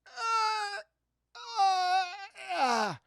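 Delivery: high, straining mock groan; imitated effort of a spent fighter; exhausted groans. Tired Scotsman attempts the killing blow, exhausted groaning. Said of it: "they're just so fucking tired".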